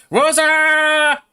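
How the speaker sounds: high, loud playful call of Rosa. Retold or imitated. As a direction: sing out the name Rosa. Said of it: "I like".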